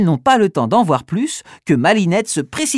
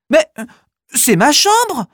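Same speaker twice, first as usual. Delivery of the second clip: high, louder astonished cry; surprised cry; 10 semitones higher, 4 dB louder than usual. Acted. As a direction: shout out in surprise. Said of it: "s'écrie-t-elle".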